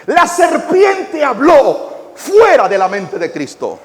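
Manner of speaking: full-force preaching. Acted.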